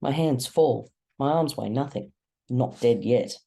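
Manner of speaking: usual speaking voice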